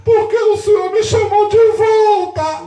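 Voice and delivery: high, loud, drawn-out imitated wail; mock tearful complaint. [name] to pastor who called him back, mock crying. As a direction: imitate a crying protest, stretching the words. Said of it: "por que tu tá chorando".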